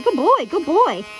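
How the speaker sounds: slow, encouraging praise over the noise; praising the dog. Real, not acted. Groomer praising dog during nail grinding, encouraging. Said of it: "Always praising the dog".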